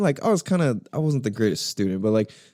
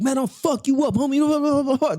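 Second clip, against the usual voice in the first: high, worked-up re-enacted rant; aggressive threats; 14 semitones higher than usual. Student cursing out a kid while leaving class, high and heated. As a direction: throw threats on your way out.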